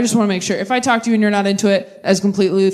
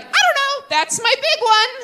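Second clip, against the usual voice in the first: put-on naive kid; 5 semitones higher, slower than usual. Naive kid self, airy.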